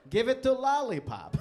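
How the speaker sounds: high, loud, laughing call; laughing shout. Pretend. Joking about giving the letter away, loud laughing shout.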